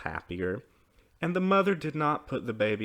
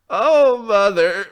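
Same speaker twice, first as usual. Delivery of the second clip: acted high, loud child's wail; sobbing; 10 semitones higher, 15 dB louder, much slower than usual. A narrator's reading. Child runs into mother's arms, sobbing. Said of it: "she sobbed".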